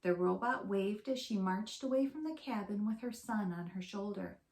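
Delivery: usual speaking voice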